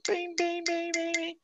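high tinkling bim bim of a glass tapped; mimicking a glass chime to hush a party; glass clinking. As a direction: ring it out like a spoon on a champagne glass. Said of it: "daí eles batem assim".

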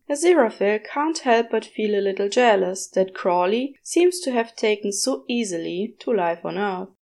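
ordinary delivery

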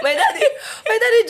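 high, laughing through words; high-pitched laughter spilling into the words. Real, not acted. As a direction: laugh high while saying my daddy. Laughing while starting story.

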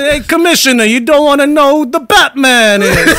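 high, loud mock Bronx tough guy; hammed-up Bronx accent, pushed up in pitch; Andrew Dice Clay. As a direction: do a brash Bronx wise-guy impression. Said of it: "Why is he doing Andrew Dice Clay?".

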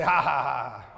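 put-on "ha ha" at someone; mock jeering laugh. Shown as a demonstration. Pointing at the rejects, mock jeering laugh. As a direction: laugh at them in an exaggerated fake way. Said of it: "Laugh at 'em".

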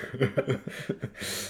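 soft unvoiced laugh; a quiet breathy chuckle. Real, not acted. Laughs at own story.

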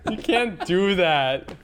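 high, loud, drawn-out laughing protest; helpless laughter. Real, not acted. Friend reacts to the crude joke, laughing hard. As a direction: wail it through real laughter.

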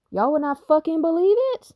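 high, loud burst of disbelief; excited outburst. Real, not acted. Telling viewers she fought demons, high excited outburst. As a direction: pitch shoots up on "fucking believe it".

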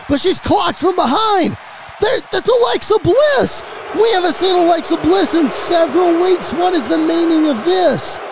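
high-pitched, astonished announcer call, easing gradually; shocked play-by-play. Acted. Commentator sees Alexa Bliss attack Bianca, shocked play-by-play. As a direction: sell the shock of a surprise return.

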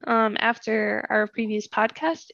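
ordinary talk

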